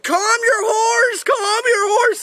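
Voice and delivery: high, slow frantic shout; counselor yelling. Retold or imitated. Counselor shouting at the kid on a bucking horse, high frantic yell. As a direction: bark the order at the rider in a panic.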